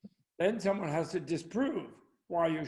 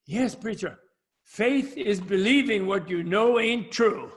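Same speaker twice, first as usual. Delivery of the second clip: put-on louder, higher Southern boy; little Johnny; 3 semitones higher, 6 dB louder than usual. Little boy answering the preacher, loud Southern voice. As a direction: belt out the punchline as a proud Southern pupil. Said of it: "And little Johnny said".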